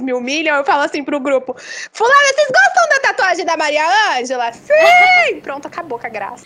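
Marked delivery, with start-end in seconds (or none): 1.9-4.5 calling out. Retold or imitated. Calling out to the class. 4.5-5.4 high, loud eager yes; kids answering in chorus. Retold or imitated.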